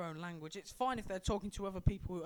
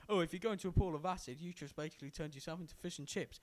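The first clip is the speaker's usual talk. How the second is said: quick mocking imitation of his friend; mock quote; 2 semitones lower, faster than usual. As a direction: rattle it off fast, teasing. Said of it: "when you say".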